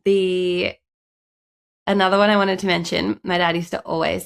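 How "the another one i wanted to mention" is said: ordinary delivery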